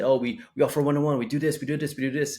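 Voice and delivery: high, loud rattled-off list of features; hammed-up clinic pitch, piling on features; mock sales pitch. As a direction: oversell it like an eager salesperson.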